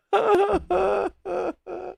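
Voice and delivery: long high shrieking laugh; high burst of laughter. Real, not acted. Streamer losing it at chat, high laugh. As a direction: crack up hard. Cracking up.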